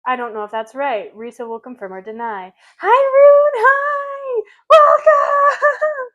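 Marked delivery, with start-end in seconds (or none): 2.8-6.1 high, loud, gushing welcome; excited greeting. Real, not acted. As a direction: squeal hello to a new friend. Excited greeting to new viewer.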